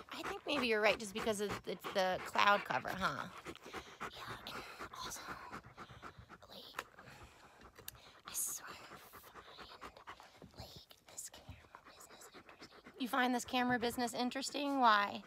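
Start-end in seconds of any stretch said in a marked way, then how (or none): 4.0-12.9 soft breathy whisper as the dog; dog's inner voice. Acted. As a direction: whisper the dog's wise words. Voicing the dog.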